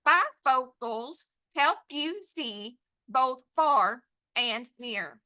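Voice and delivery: "Bifocals help you see" in slow separate beats; pounded word by word. Shown as a demonstration. Teacher dictating to students, pounded word by word. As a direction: pound out each word from "Bifocals" to "near", pausing between. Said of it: "Pound it with me".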